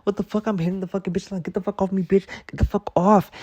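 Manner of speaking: high, shrill panic; panicked screaming. Retold or imitated. Hitting the attacking hawk, high panicked screaming. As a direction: scream at the bird in terror.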